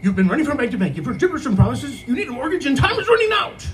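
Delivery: radio spot climbing to a high, fast, hammed-up finish; exaggerated ad read. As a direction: oversell the deadline like a cheesy commercial. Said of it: "I want you to exaggerate it".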